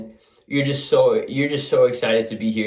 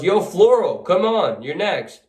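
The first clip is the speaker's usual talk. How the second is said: hearty raised call, pitched high then easing; yelled call-out; 7 semitones higher, 3 dB louder than usual. Acted. The other guy at the pong table calls you over, yelling. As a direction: call someone over across a noisy party. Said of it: "He yells".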